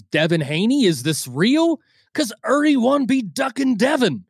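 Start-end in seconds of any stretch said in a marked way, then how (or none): 2.1-4.2 high put-on voice mocking a promoter; mock boxing promoter voice. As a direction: sneer it like a cheap promoter.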